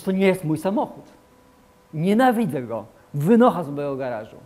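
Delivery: głośno i z gniewem wyrzuca intruza; twarde, głośne „wynocha”; stanowcze wyrzucenie. Retold or imitated. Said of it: "Ale ty mówisz". (stanowczy, głośny gniew wobec zła)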